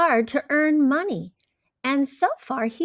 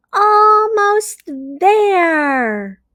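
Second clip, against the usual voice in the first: higher, slow, drawn-out and eager; eager Pete; 8 semitones higher, 6 dB louder, much slower than usual. Acted. Pete counting his savings, slow and eager. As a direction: stretch it out with excitement. Pete's line in story.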